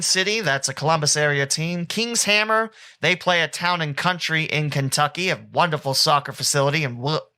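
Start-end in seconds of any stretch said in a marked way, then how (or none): none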